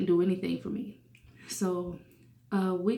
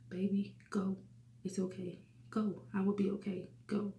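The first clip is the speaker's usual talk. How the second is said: soft, gentle grandma's plea, much softer; grandma softly urging her grandchild to go, quiet and gentle; softest voice; 6 dB quieter than usual. Retold or imitated. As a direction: hush it down, tender and soothing, to a grandchild. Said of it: "with the softest voice".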